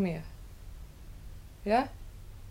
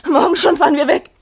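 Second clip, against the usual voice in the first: excited; 3 semitones higher, 15 dB louder, faster than usual. Acted. Excited outburst.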